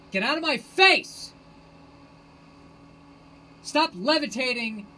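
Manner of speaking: high, loud, slow furious yell; mock yell at Buddha. Yelling at the levitating Buddha, hammed-up fury. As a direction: bellow it in his face. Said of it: "And I would tell the Buddha".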